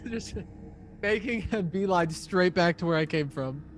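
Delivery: ordinary talk, nothing marked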